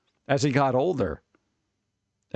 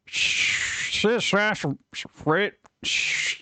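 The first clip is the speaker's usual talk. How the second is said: radio static hiss; 5 semitones higher, much slower than usual. Pretend.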